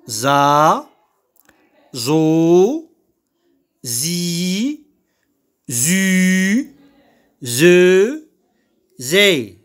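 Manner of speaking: each syllable "za", "zo", "zi", "zu", "ze", "zé" said alone with a pause after it; syllable drill. Shown as a demonstration. Teacher drilling "za", "zo", "zi", "zu", "ze", "zé" for pupils. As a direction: sound out each syllable clearly for the class. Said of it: "Donc je répète".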